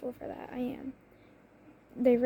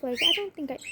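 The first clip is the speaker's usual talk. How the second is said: usual speaking voice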